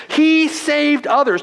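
high, scornful mock of the crowd; sarcastic sneer. Preacher voicing rulers mocking Jesus, sarcastic sneer. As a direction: sneer it with contempt. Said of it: "You just hear the sarcasm in their voice".

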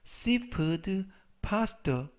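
very slow, careful English; slow model reading. Shown as a demonstration. Teacher reading slowly to students. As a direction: spell it out slowly for learners. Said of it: "우리는 저녁으로 해물 파스타를 먹었다라는".

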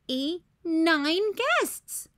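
drawn out slowly, rising; slow dismayed count. A narrator's reading. Ruby counts nine guests, slow and baffled. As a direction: stretch the final count in disbelief. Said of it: "said Ruby".

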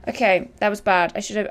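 normal talking voice

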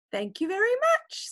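very high, eager kitten. Acted. Kitten Max calls up to the birds, very high. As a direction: ask up into the tree as a small kitten.

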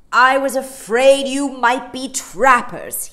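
loud, high, hearty bear; polar bear. A narrator's reading. The polar bear greets Mary Poppins, loud and hearty. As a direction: boom a big bear's welcome.

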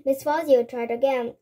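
ordinary delivery, nothing marked

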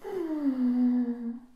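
long, loud, heavy sigh; deep sigh. A narrator's reading. Rhino misses his family, sighing deeply. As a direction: let out a big sigh through the nose. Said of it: "The rhino sighed deeply".

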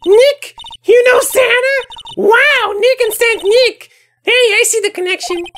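put-on high, excited girl voice; Maya delighted. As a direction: gush over the pun.

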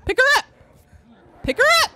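high loud call to the team; urgent shout. Real, not acted. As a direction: yell for them to help. Calling teammates urgently.

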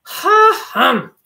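high, loud startled harrumph; surprised exclamation. A narrator's reading. Rabbit surprised as spectacles fall in mud. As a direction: huff out in surprise. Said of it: "exclaimed the old gentleman rabbit".

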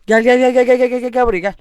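taunting call. Real, not acted. Taunting enemy player in game.

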